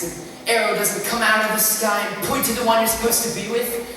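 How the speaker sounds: ordinary delivery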